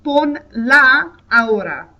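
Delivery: slow, careful "Pon la ahora" with a tapped r; teacher showing the pronoun attached to the command; slow Spanish demo. Shown as a demonstration. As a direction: model the short command slowly.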